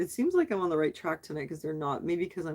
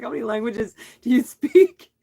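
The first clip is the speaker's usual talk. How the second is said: mock amazed man, louder and higher; amused stranger; 5 semitones higher, 9 dB louder than usual. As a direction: imitate the laughing guy asking in disbelief. Retold amused train station man.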